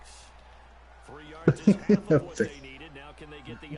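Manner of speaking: long high airy laughter; breathless laugh. Real, not acted. Cracking up at panda answer.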